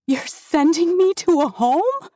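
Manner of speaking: horrified question. Acted. Daughter horrified at father's plan, high cry. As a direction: recoil in horror. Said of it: "full horror of it hit her".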